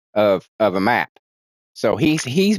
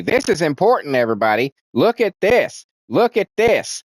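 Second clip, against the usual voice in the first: higher, hammy look-at-this; mock pointing; 6 semitones higher than usual. Mocking films that point the camera, higher mock urgency. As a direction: ham up an over-eager guide.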